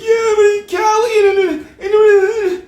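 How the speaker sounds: very high squealing falsetto; mocking airhead. People defending America with Cali, mocking squealy falsetto. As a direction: babble like a gushing defender, hammed-up and squeaky. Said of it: "They be like".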